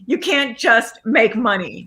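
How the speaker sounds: normal talking voice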